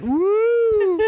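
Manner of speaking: a wild squeal way up high; high falsetto woo. Retold or imitated. Fat Bender cheering at his party, high squealing woo. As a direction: whoop it out in a thin high squeal.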